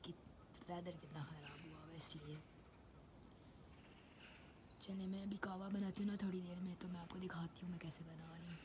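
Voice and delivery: lost voice, whispering hoarsely; real sore throat, barely any voice. Real, not acted. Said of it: "बोला नहीं जा रहा।".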